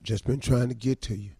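low, mumbled excuses of a sheepish husband; meek husband role. Acted. Husband caught coming home late: sheepish low excuses. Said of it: "I'm the guy".

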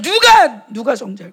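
acted emphatic challenge; forceful proclamation. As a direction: proclaim the word out loud. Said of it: "말씀을 막 선포하는 거예요".

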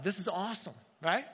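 ordinary delivery

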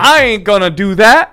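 loud, high, put-on urban sass; mock urban affect. Interpreter mocking suburban mom trying to be urban, exaggerated. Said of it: "they try to match racial affect".

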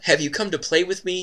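high, lightly voiced little girl. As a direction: ask it like a tiny child. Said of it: "you hear a little voice ask".

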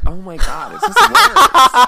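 high, loud laughing cry; shrieking with laughter at own joke. Real, not acted. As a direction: burst out laughing mid-sentence.

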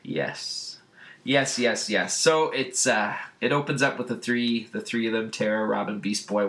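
usual speaking voice

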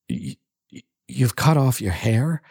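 laborious stammer. A narrator's reading. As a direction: struggle to get the words out. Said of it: "asked Jim laboriously".

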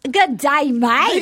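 hammed-up Aussie greeting; fake Australian accent. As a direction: put on a broad Australian accent. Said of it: "My friend who's Australian".

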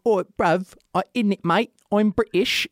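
high, hammed-up bad British accent. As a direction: imitate a terrible British accent. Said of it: "My girlfriend does, like, a really, really bad one".

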